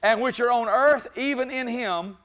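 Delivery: preacher rising to a shouting peak; preaching at full force. Acted. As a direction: lift the church with each phrase.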